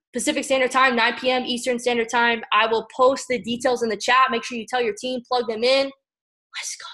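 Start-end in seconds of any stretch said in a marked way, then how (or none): 5.9-6.9 a breathy whispered send-off. Acted. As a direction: whisper the rallying cry. Hyping the team at the end.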